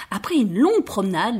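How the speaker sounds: ordinary delivery, nothing marked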